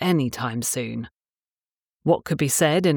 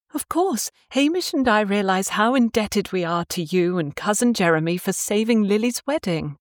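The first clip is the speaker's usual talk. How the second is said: Scottish mother voice; 6 semitones higher than usual. Acted. Jane's grateful speech voiced.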